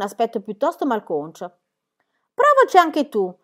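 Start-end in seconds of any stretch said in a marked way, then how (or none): 2.3-3.3 high, loud challenge; retort to scolding. A narrator's reading. Jim answering Book's scolding, high loud retort. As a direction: throw the dare back loudly. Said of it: "disse a Book che lo stava rimproverando".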